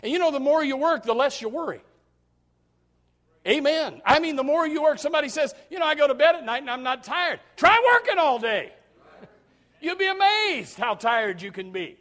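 loud, rising preacher's call to the congregation; fiery preaching. Acted. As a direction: drive it home like a revival preacher. Preaching at full force.

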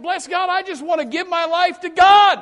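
loud, high eager cry of devotion; zealous shout. Retold or imitated. Preacher imitating a convert's loud devoted cry. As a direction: shout it like a fired-up new convert.

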